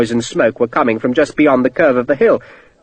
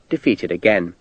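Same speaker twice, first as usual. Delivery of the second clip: plain reading voice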